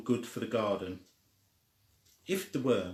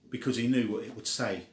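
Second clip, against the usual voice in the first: ordinary delivery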